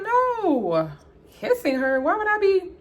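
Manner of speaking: high, loud protest; overdone innocent outrage; indignant denial. Retold or imitated. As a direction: deny it as if it's absurd. Acted loud denial of accusation.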